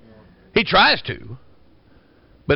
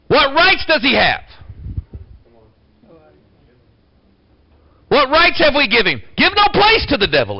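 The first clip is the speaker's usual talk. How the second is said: preaching at full force; 6 semitones higher than usual. Acted. Pastor rousing his church with raised preaching voice. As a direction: fire up the congregation, voice raised.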